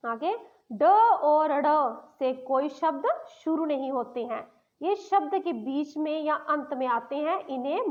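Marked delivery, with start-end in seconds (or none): none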